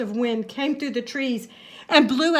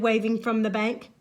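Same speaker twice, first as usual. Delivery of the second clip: ordinary delivery, nothing marked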